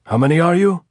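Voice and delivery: high, loud repeated demand; shouted challenge. A narrator's reading. Sentry shouting the challenge again, loud. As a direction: bark it down from the parapet. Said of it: "Then the voice shouted again".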